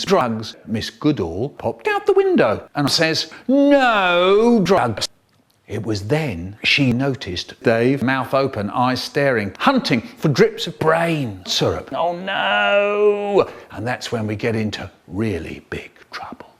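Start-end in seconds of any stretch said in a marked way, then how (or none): 3.5-5.1 high, drawn-out cry; acted teacher's alarmed call; called-out warning. Teacher calls out of window. 11.9-13.5 high, long-held mock dismay; hammed-up horrified cry; cry of alarm. Acted alarm in story.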